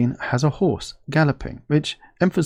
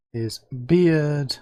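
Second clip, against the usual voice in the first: normal talking voice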